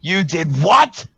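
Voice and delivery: loud, slow shocked yell; you did what, shouted. Acted. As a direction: yell it in disbelief. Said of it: "she yells".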